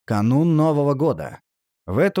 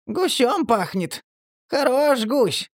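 very high, slow squeaky whine; plaintive creaky voice; 12 semitones higher, slower than usual. A narrator's reading. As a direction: creak it out pitifully. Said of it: "Жалобно скрипнуло создание".

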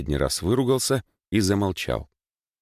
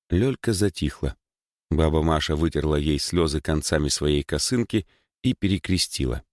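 usual speaking voice

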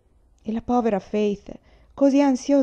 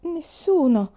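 put-on small, high girl's voice; timid reply; 7 semitones higher, 3 dB louder, much slower than usual. A narrator's reading. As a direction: answer meekly, pitched up. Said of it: "disse la povera Faith".

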